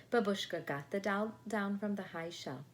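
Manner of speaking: ordinary delivery, nothing marked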